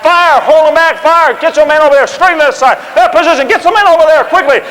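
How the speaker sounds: loud, high urgent commands; officer shouting to his men in retreat; frantic shouted orders. Retold or imitated. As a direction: yell over the gunfire. Washington yelling orders while retreating.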